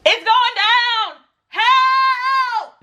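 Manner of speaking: high slow wailing cry for help; mock panicked yell. Calling out inside her head for help, high panicked wail. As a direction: shriek it like the world is ending. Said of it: "'cause I was like".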